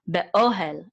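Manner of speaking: be-OH-el, the O hit hardest; stress on OHEL's O. Shown as a demonstration. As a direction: punch the "o" in be-ohel. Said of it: "over the o sound".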